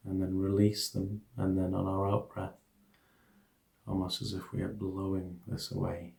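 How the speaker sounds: soft, hushed meditation guidance; guided meditation. Acted. Teacher guiding listeners through an equanimity meditation, soft hushed guidance.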